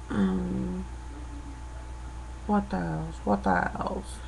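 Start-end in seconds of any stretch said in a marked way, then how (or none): 3.2-4.2 the same words whispered to herself. Real, not acted. Woman muttering to herself, softly whispered. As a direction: breathe the question under your breath. Thinking aloud, then repeats it.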